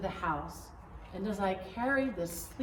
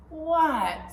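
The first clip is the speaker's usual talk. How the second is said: eager, louder question; 6 semitones higher, 8 dB louder than usual. Real, not acted. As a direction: blurt it out eagerly.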